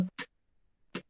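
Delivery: T as a bare consonant, no vowel; isolated T sound. Shown as a demonstration. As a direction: release a voiceless "T" with no vowel after it. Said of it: "Ese sonido es el sonido".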